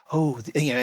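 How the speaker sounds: ordinary talk, nothing marked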